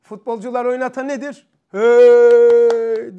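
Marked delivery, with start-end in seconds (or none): 1.7-3.2 long, very loud held shout; roused crowd cheer. Acted. Teacher imitating a fan rousing footballers, long loud cheer. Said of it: "diye o da coşuyor".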